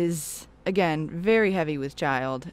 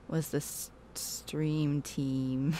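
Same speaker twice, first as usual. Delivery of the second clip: ordinary talk, nothing marked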